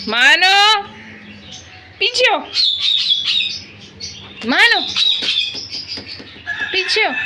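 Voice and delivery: real scolding yell at a misbehaving pet; scolding shout. Real, not acted. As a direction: bark it sharply at the pet to back off.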